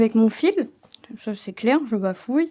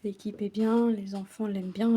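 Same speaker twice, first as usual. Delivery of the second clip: usual speaking voice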